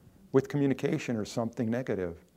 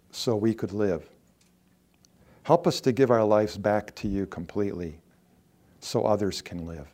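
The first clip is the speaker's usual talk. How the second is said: heartfelt closing prayer; 3 semitones lower than usual. Real, not acted. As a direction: pray it sincerely, unhurried.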